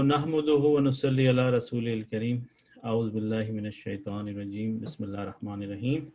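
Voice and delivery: low, quick, softened recitation; murmured formulaic Arabic blessing, run through fast; Arabic opening invocation. Real, not acted. Opens lesson with Arabic invocation.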